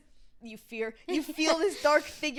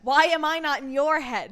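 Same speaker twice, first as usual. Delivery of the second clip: demanding; 9 dB louder, slower than usual. Retold or imitated. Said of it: "She's like".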